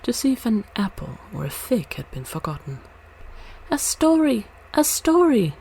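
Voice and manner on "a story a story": put-on high, loud eager cries from the kids; children begging for a story, high and loud; excited children crying out. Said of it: "cried the children".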